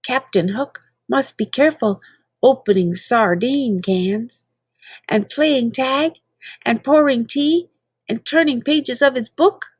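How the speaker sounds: plain reading voice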